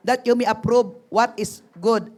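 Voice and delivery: loud, emphatic Bible reading; scripture reading. Acted. Pastor reading the verse aloud to the group, emphatic. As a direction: read the verse out to the group, firmly.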